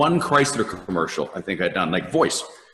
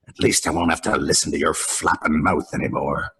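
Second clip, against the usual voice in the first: Wolverine; 5 semitones lower than usual. Retold or imitated. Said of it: "Wolverine is floating ... he says".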